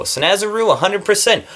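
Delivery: high, eager emphasis; excited endorsement. Real, not acted. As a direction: sell it with a bright high push. Raving about favorite makeup.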